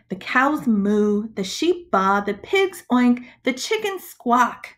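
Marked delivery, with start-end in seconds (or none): none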